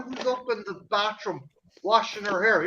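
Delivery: ordinary delivery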